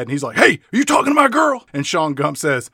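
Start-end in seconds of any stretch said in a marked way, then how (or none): 0.4-1.7 put-on high, fast jealous-boyfriend challenge; hammed-up aggressive boyfriend, quick and high; mock abusive jerk. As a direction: play a hot-headed bully. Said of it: "as an abusive shithead".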